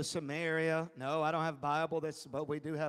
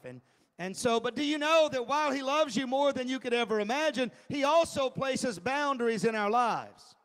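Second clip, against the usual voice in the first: louder, higher preaching with punched words; 4 semitones higher, 5 dB louder than usual. Acted. Preacher to congregation, pressing questions loudly. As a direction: press each question on the crowd.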